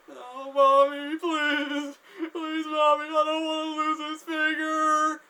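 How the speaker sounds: high, loud, slow tearful wail; terrified pleading. Retold or imitated. Man begging Mommy to save his finger, terrified pleading. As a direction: cry out like a child for mother.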